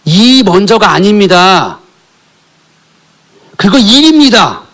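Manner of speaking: fervent sermon climax; full-force preaching. Acted. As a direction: preach it out at the congregation.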